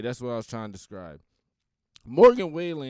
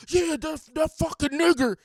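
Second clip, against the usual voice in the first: much higher, louder, ranting impression of a rabid hateful loudmouth; Alex Jones temperament; 8 dB louder than usual. Showing how the singer did NOT say it, as a much higher, louder ranting rabid impression. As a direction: spit it out like a frothing conspiracy ranter. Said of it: "like, that Alex Jones temperament".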